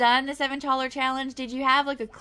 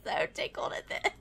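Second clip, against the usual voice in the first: tickled, giggle-choked speech rising high; losing it with laughter; cracking up; 13 semitones higher, 3 dB louder than usual. Real, not acted. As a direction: talk through real giggles. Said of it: "It's just cracking me up".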